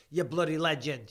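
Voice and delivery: ordinary talk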